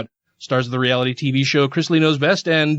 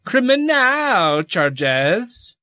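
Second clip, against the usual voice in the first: very slow, higher Southern drawl; hammed-up Southern drawl; 6 semitones higher, much slower than usual. Host repeating the charges for effect, exaggerated Southern drawl. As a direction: stretch it out in a mock Southern accent.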